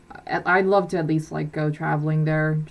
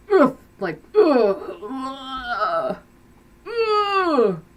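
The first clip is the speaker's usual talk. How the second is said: indulgent groan; 7 semitones higher, much slower than usual. Acted. Craving cheesy mac and cheese, playful pleasure groans. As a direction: groan as if tasting something too rich.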